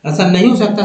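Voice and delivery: ordinary delivery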